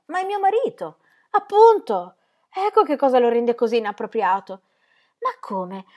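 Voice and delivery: high, loud outburst on Appunto; heated retort. Acted. Ingram snapping back at Ingeborg about her husband, exasperated.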